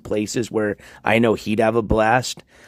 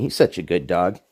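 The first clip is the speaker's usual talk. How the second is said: normal talking voice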